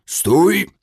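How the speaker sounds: rasping high shout, furious; hoarse dwarf shout. A narrator's reading. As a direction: croak out a raging stop. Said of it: "wycharczał krasnolud". (charczący krzyk krasnoluda)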